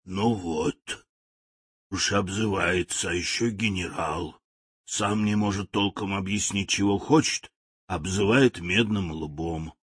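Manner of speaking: low, soft creaky grumble; hoarse machine voice. Acted. Talking machine grumbles at the general hoarsely. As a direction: grumble like a rusty old machine. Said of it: "её собственный хриплый голос".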